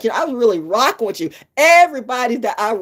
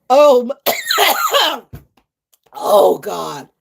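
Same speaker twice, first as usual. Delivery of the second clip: laughing; 6 semitones higher, 4 dB louder, slower than usual. Real, not acted. Recovering from laughter.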